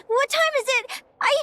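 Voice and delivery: high, tearful question; through tears. Acted. Linnea, just woken and shaken, asks her friend through tears. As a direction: ask it while still crying. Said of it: "why are you crying?".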